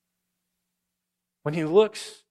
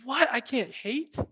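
mock disbelief; 9 semitones higher than usual. Mock listener reacting to command.

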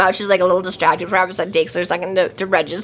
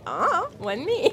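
mock innocent; 7 semitones higher, 8 dB quieter, slower than usual. Marcone's mock-innocent line.

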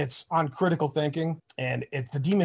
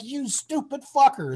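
mock exasperated insult; 10 semitones higher than usual. Friend Brad berating him in retelling.

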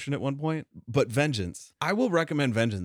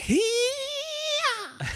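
high Xena battle cry; warrior cry. Retold or imitated. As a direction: yell it like a warrior princess. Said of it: "in my mind, I was like".

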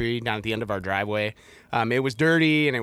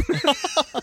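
high laugh; 14 semitones higher than usual. Real, not acted. Laughing at son's lawnmower plan.

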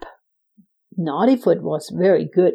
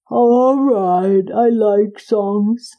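slow, content giant; a big slow giant who likes songs; slower than usual. Acted. Giant voiced in story.

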